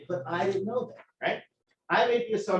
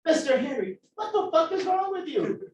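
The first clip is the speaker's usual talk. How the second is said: high-pitched retold reprimand; exasperated TA; 9 semitones higher than usual. TA confronting the student who stormed out, exasperated. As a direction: call out a student in disbelief, pitched up.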